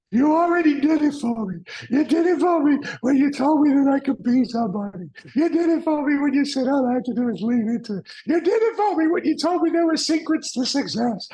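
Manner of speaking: high, loud, breaking voice through tears; sobbing. Retold or imitated. Coaching client thanking his mentor, crying loudly. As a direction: blurt it out while crying hard, overwhelmed with gratitude. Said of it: "I just started crying like a baby".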